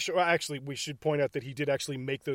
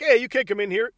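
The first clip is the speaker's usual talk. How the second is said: louder, higher call to intruders; quoted protest with raised voice; mock challenge; 6 semitones higher, 6 dB louder than usual. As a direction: call out as if stopping someone at the door. Quoting student confronting intruders.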